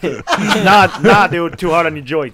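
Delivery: hammed-up Boston brush-off through laughter; Bill Burr Boston accent. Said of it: "his Boston accent ... in that moment".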